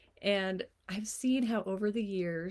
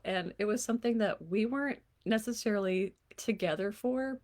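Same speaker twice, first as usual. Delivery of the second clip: ordinary talk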